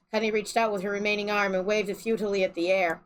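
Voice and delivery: usual speaking voice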